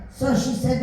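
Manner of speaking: usual speaking voice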